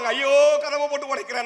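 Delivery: mock cry of alarm, pitched high; retold wail. Aunt crying out as her son breaks the door, mock wail. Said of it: "எங்க பெரியம்மா அலறுவாங்க".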